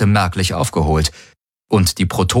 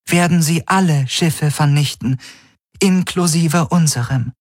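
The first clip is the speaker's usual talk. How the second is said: higher, measured, slowing on the threat; AI delivering an ultimatum; 9 semitones higher than usual. Acted. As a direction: lay out the terms evenly.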